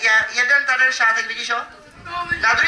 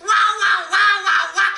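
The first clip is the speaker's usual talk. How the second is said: magic wow; 9 semitones higher, slower than usual. Shown as a demonstration. Magician shows the child what to shout, high excited wow. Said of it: "udělám jenom wow".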